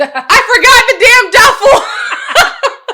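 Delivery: high, slow, drawn-out yell; panicked shout. Retold or imitated. Traveler remembers her forgotten duffel, hammed-up panicked shout. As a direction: wail it at full volume, half laughing. Acts realizing bag was left behind.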